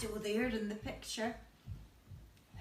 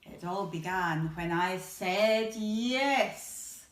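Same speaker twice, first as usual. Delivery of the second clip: put-on reading to children; 4 dB louder, slower than usual.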